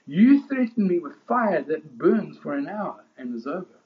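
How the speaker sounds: high, loud, driving pulpit proclamation; preaching at full force. Acted. Preacher to his congregation, at full force. Preacher voicing Polycarp's defiance.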